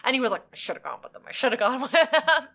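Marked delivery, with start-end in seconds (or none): 0.5-1.2 breathy whispered regret, barely voiced. Dad's regret, quoted. 1.3-2.5 higher, louder, breaking into a laugh; laughing regret. Retold or imitated.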